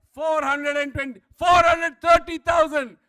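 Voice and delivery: loud auctioneer calls, building; shouted bids. Real, not acted. Auctioneer racing bids up before the room, shouting.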